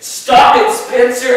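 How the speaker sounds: shout. Real, not acted. Shouts at friend.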